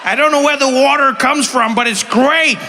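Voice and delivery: high, loud gleeful character voice; delighted mutant bather. Acted. Bather in a toxic waste spring, loud hammed-up delight. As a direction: proclaim it with oblivious comic delight.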